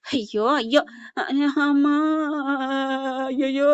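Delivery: half-hearted acted wailing; mock crying. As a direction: imitate sobbing "ayyo"s. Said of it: "அப்படின்னு அழுகுதுங்க". (பாவனையாக அழுது புலம்புவது)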